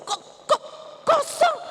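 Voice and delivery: stuttering, rising to high; stunned stammer; stammering shock. Acted. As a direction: choke out the word in disbelief. Finding the tomb empty.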